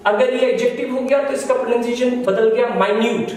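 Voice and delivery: ordinary delivery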